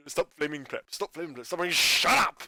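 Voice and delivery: imitated fed-up outburst; building to a snap. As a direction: drone the line, then suddenly yell. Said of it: "It's just the build up to it".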